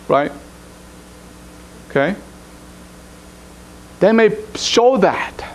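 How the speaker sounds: ordinary talk, nothing marked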